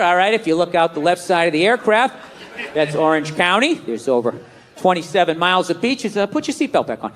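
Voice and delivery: low, stiff rehearsed tour spiel; new pilot reading his speech to the passenger; nervous tour guide. Retold or imitated. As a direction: recite the tour speech by rote.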